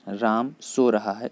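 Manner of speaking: slow, deliberate reading of राम सो रहा है with सो lifted; slow repeat. Shown as a demonstration. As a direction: spell out the example slowly for students. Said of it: "teacher repeats example sentence slowly".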